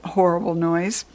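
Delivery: ordinary delivery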